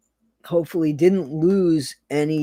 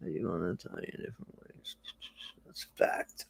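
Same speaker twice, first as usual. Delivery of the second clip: whisper; 9 semitones lower, 19 dB quieter, faster than usual. Real, not acted. Whispered aside to chat.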